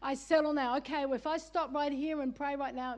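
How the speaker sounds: ordinary talk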